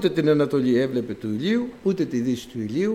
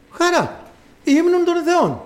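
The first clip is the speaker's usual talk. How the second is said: high bright exclamation; joyful cry; 12 semitones higher, 3 dB louder, slower than usual. Real, not acted. Preacher crying out joy to the congregation. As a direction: call it out with delight.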